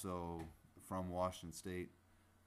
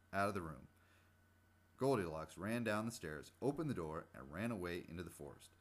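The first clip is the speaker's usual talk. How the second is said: put-on livelier, louder story narration for children; storybook reading; 4 dB louder than usual. A narrator's reading. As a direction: read the fairy tale with bright, lively energy. Reads Goldilocks aloud.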